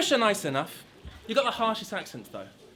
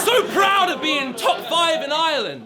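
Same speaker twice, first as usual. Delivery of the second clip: higher, louder mock boast; mocking shout; 6 semitones higher, 5 dB louder than usual. Mocking his rival's top five boast, loud and sneering. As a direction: sneer it like a taunt.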